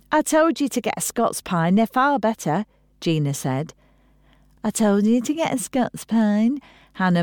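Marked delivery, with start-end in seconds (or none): 0.1-2.6 higher-pitched I-told-you-so; told-you-so nagging. Acted. Gina scolding her sister about pine needles, nagging. 4.6-6.6 higher-pitched mimicry of a sister; mocking parody. Hannah mouthing her sister's words back, mocking parody. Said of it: "into a parody of her sister's".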